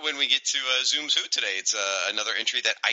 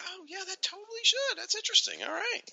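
high mock surprised voice; 5 dB quieter than usual. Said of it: "and it's like".

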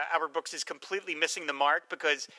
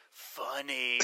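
dry low deadpan, softer; deadpan funny; 4 semitones lower, 7 dB quieter than usual. Retold or imitated. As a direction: drop it flat and quiet. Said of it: "leans in and goes".

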